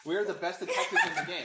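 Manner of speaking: laughing boast. Real, not acted.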